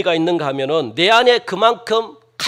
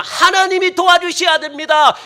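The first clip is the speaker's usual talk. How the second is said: loud, high plea; acted fervent cry for help; 9 semitones higher, 6 dB louder than usual. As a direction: insist urgently with raised voice. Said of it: "그 부르짖는 표현이 어떤 의미냐".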